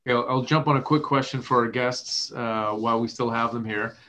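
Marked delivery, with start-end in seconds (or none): none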